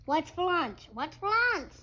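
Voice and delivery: high, loud little duck voice; eager duckling. Acted. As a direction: chirp it like a hungry duckling. Hungry duckling voice.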